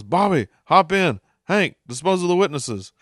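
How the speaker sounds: a hint of Dale fleeing the scene; imitating Dale. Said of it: "Dale rolls up ... says".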